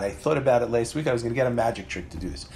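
ordinary talk, nothing marked

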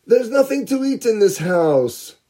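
put-on high, complaining bratty child; whiny spoiled boy. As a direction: whine it like a picky kid. Picky boy complains about food.

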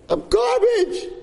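slow, high drawn-out wail; screaming. Retold or imitated. New kid wailing I'm garbage in the study hall. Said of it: "starts screaming".

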